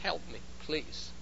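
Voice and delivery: soft, hushed plea for help; quiet appeal to the Holy Spirit; pleading prayer. Acted. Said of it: "He turns to the Holy Spirit and says".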